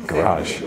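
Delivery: GARAGE as guh-RAHZH, stress on the second syllable, falling; American-style GARAGE, said as a statement; American pronunciation. Shown as a demonstration. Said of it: "How do you say it in America?".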